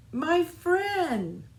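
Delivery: high, loud happy cry; gleeful exclamation. Acted. Little Seed greeting Fox again, gleeful shout. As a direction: burst out with joy. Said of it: "they each exclaimed with glee".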